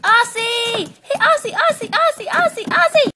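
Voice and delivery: very high, loud, rapid-fire pestering; shrill, excited nagging call; insistent calling. Acted. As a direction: keep calling the name until they wake. Pestering a sleeper awake.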